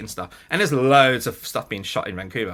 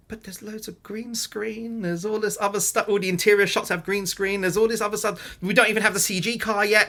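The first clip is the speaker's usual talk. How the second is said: anxious protest; 8 semitones higher than usual. Retold or imitated. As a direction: plead in rising worry. Panicking about unfinished shots.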